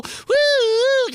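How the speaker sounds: loud, very high woo; whooping line-noise effect; imitated phone noise. Caller's breaking connection, loud imitated whoop.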